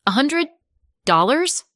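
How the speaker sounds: high, rising shocked question; can't believe what you heard; stunned disbelief. Acted. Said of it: "My brain did a strange little stutter".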